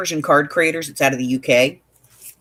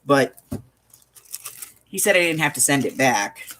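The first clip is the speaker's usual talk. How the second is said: ordinary talk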